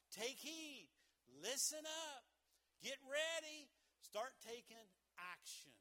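rising preacher's charge; building exhortation. Acted. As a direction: drive the commands home. Preacher builds urgent commands.

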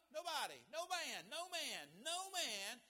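loud, high emphatic no man; rising preacher's cry; preaching emphasis. Acted. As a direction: pound each no man home. Preacher hammering key phrase.

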